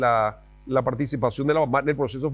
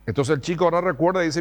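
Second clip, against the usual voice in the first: usual speaking voice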